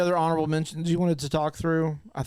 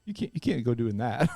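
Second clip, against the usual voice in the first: usual speaking voice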